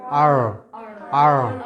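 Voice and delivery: low American R with curled, trembling tongue; American accent R. Shown as a demonstration. Teacher shows American style, deep rolled-back r. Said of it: "যদি American স্টাইলে বলি".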